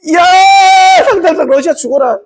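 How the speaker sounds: high-pitched mock war cry; playful soldier's charging yell; battle cry.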